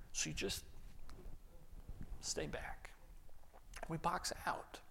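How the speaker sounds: hushed, breathy aside; whisper. Real, not acted. Preacher whispers to the congregation about boxing people out. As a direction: whisper it like a confession.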